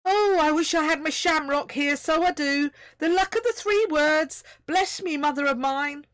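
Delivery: high, loud, Irish-lilted delight; praising Irish tiny voice. A narrator's reading. Tiny Irish voice praises Onk: delighted. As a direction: heap praise in a thrilled Irish lilt. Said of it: "the first voice went on".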